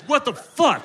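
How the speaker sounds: high, startled what-the-fuck; sudden high outraged squawk; shocked yell. Retold or imitated. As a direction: jolt up in shock, laughing. Shocked reaction to creepy uncle.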